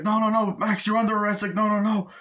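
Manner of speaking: high, loud panicked repeats; mock panic during an arrest; frantic protest. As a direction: protest desperately while being grabbed. Cops grabbing Max in chaos.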